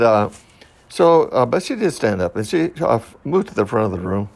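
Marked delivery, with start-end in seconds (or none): none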